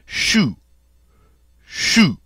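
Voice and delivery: "chou" said like shoe, pitched high; French "chou", high and falling; French oo sound. Shown as a demonstration. Teacher gives word for cabbage, French oo sound. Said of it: "like the word for cabbage in French".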